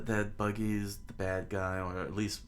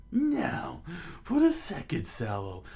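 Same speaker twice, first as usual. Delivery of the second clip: hammed-up villain, breaking into cackles; villainous clown captain; slower than usual. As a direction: announce it with evil glee. Buggy orders fire, cackling.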